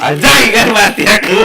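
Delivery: sudden high startled cry; excited outburst. Real, not acted. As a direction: blurt it out as you get killed. Player dies in game.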